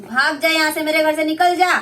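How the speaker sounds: mother-in-law drives bride out; angry eviction. Acted. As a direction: throw her out of the house.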